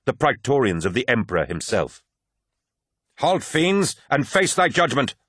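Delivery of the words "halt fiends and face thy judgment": high, imperious warrior's shout; shouted command. A narrator's reading. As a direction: shout a formal challenge at enemies. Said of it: "shouted the lead warrior".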